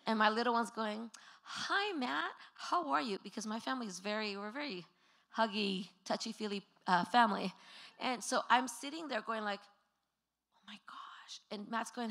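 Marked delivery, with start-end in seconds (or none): 1.4-3.2 put-on high-pitched small child's greeting; high, friendly little-boy impression; little kid voice. Five-year-old greeting Matt, voiced by mother. 10.6-11.4 whispered, embarrassed aside; hushed retold dismay; mortified whisper. Mother's embarrassed inner reaction, retold.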